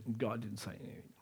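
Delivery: breathy hushed whisper. Real, not acted. Pastor telling the congregation God was silent, whispered. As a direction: let it drop to a whisper.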